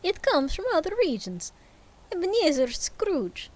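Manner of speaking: high accidental Russian ghost; Russian accent. Acted. Ghost addresses Scrooge in a high Russian accent. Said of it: "we're not Russian".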